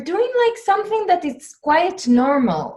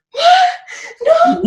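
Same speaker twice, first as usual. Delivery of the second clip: high shrieking panic, full volume; panicked scream; 7 dB louder than usual. Retold or imitated. Wife hunting for stolen earrings, panicked scream. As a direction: scream it like your valuables are gone. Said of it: "like, all overreacting".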